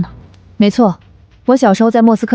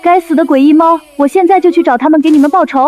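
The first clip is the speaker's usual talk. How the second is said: raised voice, anxious then vengeful; calling through door; 5 semitones higher than usual. Acted. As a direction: shout through the door, alarmed. Voice outside door shouting in. (隔着门焦急地大喊)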